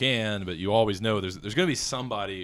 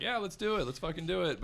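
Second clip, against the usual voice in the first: high, excited imitation of a pumped-up actor; eager actor; 10 semitones higher than usual. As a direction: mimic someone itching to go. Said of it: "Lou was standing there like".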